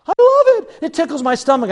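high squeal of delight, easing down; girlfriend imitation.

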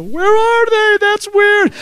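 put-on high, loud, baffled kid voice; baffled child. Boy searching for his mother's hidden eyes, baffled. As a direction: pitch it way up, puzzled and emphatic.

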